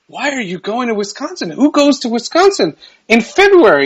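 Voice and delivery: incredulous. Retold or imitated. Retold disbelief at Wisconsin trip.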